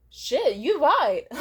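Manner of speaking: slow loud dawning to her friend; stunned realization. Retold or imitated. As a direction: let it sink in slowly.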